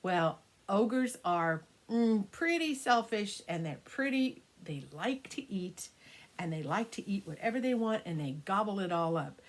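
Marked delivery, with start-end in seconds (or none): none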